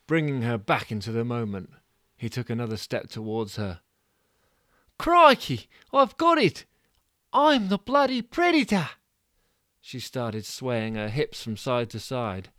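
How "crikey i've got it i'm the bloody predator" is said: much higher and louder, triumphant; excited realisation. Acted. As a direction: shriek with sudden discovery. Mudguard's breakthrough.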